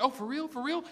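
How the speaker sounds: high, soft rising questions; amazed David. Acted. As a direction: ask twice, climbing in mock amazement. David voiced, amazed at reward.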